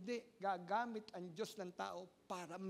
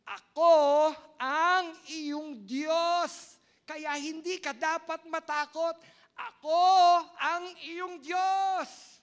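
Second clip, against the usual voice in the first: very slow, loud, high divine proclamation; booming voice of God; 7 semitones higher, 14 dB louder, much slower than usual. Acted. Pastor voicing God's declaration to the faithful, booming. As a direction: declare it as God himself, drawn out. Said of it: "dinideklara ng Panginoon".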